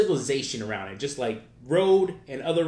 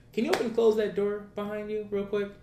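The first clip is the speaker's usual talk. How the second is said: higher-pitched retold request; imitated driver; 8 semitones higher than usual. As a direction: lightly voice the driver, pitched well up. Voices the driver turning around.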